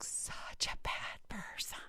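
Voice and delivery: a hushed confession to her cohost; whispered aside. Real, not acted. Owning up to her cohost, whispered guiltily. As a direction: say it under your breath, like a guilty secret. Confessing an embarrassing dating opinion.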